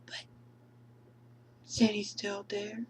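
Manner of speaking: hushed stunned voice; dazed whisper. Acted. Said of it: "from Alice at the end of the movie".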